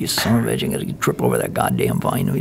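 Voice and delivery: low, quiet cursing under the breath; muttered cursing. Retold or imitated. Soldier cussing while tripping through jungle vines, muttered cursing. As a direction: grumble the oath softly and low. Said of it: "you're cussing about every two minutes".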